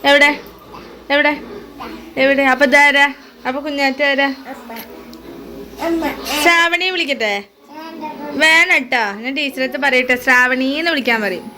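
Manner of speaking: high, loud singsong teasing. Real, not acted. Mother playfully teases about name.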